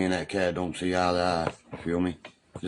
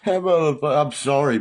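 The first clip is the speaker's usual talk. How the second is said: loud laughing rant; 9 semitones higher, 8 dB louder than usual. Real, not acted. Ranting about friend's smell.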